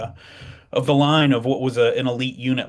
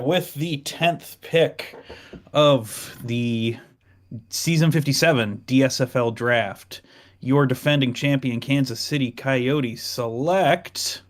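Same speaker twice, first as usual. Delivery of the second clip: announcer read; 2 semitones higher, slower than usual. Acted. Formal draft pick announcement.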